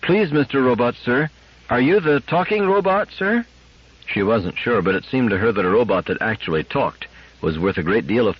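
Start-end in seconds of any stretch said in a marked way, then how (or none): 0.0-3.4 put-on high, doubtful little girl; polite, tentative girl, rising high at the end; cautious treble child. A narrator's reading. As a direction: ask timidly in a high child's voice. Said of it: "Cautiously and doubtfully she raised her treble voice".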